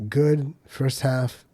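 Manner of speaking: ordinary talk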